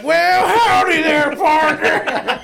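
mock Southern accent. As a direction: holler it like a hammed-up cowboy. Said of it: "talking to Southern accent".

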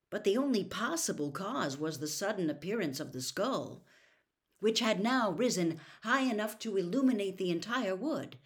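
usual speaking voice

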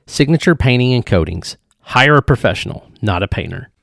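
loud, upbeat podcast ad read; sponsor ad read. Acted. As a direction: sell it like a radio spot. Host reads sponsor ad.

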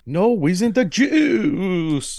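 high, very slow squeaky impression; goofy movie quote. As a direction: stretch it out high like a goof. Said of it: "movie quote impression".

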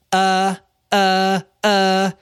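high, droning mock stammer; mocking imitation. Woman mimics him trying to find the words, mocking. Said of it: "she was being a bitch".

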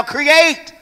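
high loud shout of create; shouted command. Real, not acted. Pastor shouting to congregation to create. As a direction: hit it hard as a command. Climax of the exhortation.

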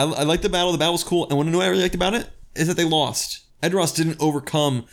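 high and eager; excited. Real, not acted. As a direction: gush about it. Enthusing about the battle.

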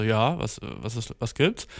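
mumbled reply. Retold or imitated. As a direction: trail off as a surprised stranger.

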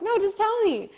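can't wait a second longer; impatient urgency. Retold or imitated. Telling her agent on the phone, eager and impatient. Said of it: "And I was like".

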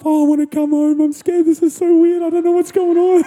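high, whiny homesick wail; hammed-up tearful whimper on one high pitch; mock crying. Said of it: "I'm crying, I'm missing my wife".

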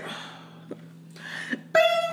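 high, fast exasperated voice; mock exasperated toddler. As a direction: sigh it out like a fed-up toddler. Voicing toddler sighing.

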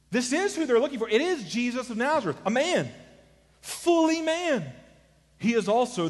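high, forceful pulpit proclamation; impassioned preaching. Real, not acted. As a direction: drive each phrase up, stretching the last two words. Preacher rises to full force.

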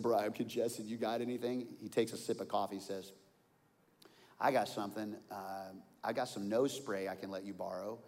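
ordinary delivery

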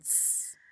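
unvoiced hiss of a falling tear; a sharp hiss like a tear; hissing tear sound.

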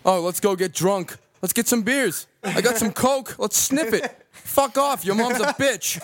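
American accent. Shown as a demonstration. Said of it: "let's hear your American accent".

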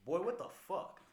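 loud, high, exasperated; exasperated shout. Retold or imitated. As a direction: yell it at them. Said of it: "And I'm like".

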